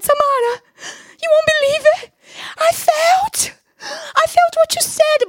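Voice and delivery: tearful excitement. Retold or imitated. Calling friend Samara about feeling God, tearful excitement. Retold phone call to friend.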